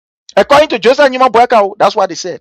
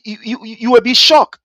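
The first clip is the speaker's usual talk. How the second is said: ordinary talk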